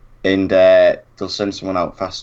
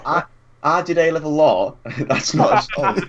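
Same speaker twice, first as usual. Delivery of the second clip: smug retold boast; 7 semitones higher than usual. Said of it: "And I went".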